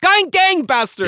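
triumphant exclaim. Real, not acted. Finally recalling the word for the host, triumphant exclaim. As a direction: shout Gun gangbusters as if you've found it. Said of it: "That's it".

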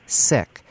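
"Sikh" with a short i, like sick; short-i pronunciation. Shown as a demonstration. Host relaying local community's request, short-i "Sikh". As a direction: make "Sikh" rhyme with pick. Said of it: "to pronounce it".

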